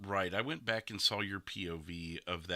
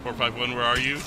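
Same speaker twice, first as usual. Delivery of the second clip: louder radio-call imitation of a dispatch ping; Ketchup's callout; 4 semitones higher, 8 dB louder than usual. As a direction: echo the urgent callout, louder. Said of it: "'Cause Ketchup's call out was".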